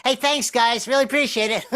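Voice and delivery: high, dorky thanks. Retold or imitated. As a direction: drop all cool and thank them in a chirpy pitch. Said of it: "he would stop being Elvis".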